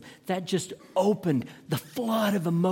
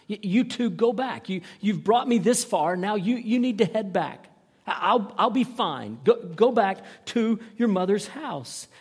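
louder, higher, insistent urging; urgent pleading; 6 semitones higher, 6 dB louder than usual. Acted. Naomi sends her daughters-in-law back to their mothers, urgent pleading. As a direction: press them firmly to turn round.

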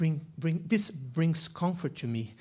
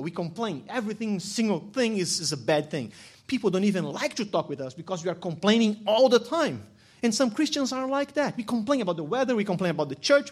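louder preaching voice, pressing each point; fired-up preaching; 2 semitones higher, 4 dB louder than usual. Acted. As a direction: scold the church about complaining, full voice. Preaching against complaining Christians.

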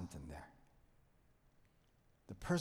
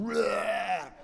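mock monster growl, higher; Hulk roar imitation; 9 semitones higher, 7 dB louder than usual. Pastor mimics Hulk transforming, mock growl. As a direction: ham up the Hulk turning. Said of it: "he turns around and just".

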